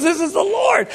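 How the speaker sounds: high-pitched pastor's revelation; emphatic exclamation. Retold or imitated. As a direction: exclaim it high, as a sudden certainty. Pastor exclaims in retold dialogue.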